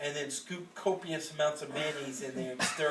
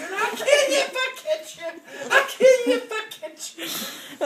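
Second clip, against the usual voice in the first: high laughing mock scream; nanny chasing someone up the hill, high laughing yell; Ruby screaming; 9 dB louder than usual. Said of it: "with Big Ruby screaming".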